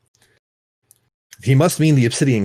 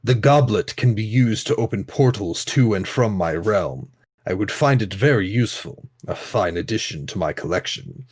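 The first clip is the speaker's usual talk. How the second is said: grand languid royal commands; 3 semitones lower than usual. Acted. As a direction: savor each word like a proud king. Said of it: "I'm having fun doing the Sultan's voice".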